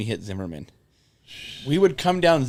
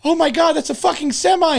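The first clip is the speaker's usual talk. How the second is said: higher and louder alarmed cry; retold panicked yell; 5 dB louder than usual. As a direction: shriek it like a truck is coming at you.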